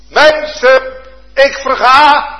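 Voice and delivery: high and loud plea; desperate cry. Acted. Peter crying to his Master while sinking, loud and desperate. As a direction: plead as one going under.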